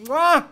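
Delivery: big loud smooch; a loud, hammed-up kiss sound; loud smacking kiss. Blowing a kiss to princess.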